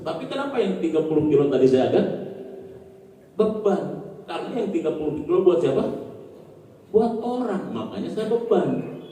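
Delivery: ordinary talk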